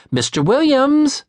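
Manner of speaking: high, loud call for the client. Acted. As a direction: call his name across the yard. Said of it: "she called again".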